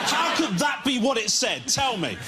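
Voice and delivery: high, frustrated parent shouting; exasperated yelling. Retold or imitated. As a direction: rant like a fed-up dad.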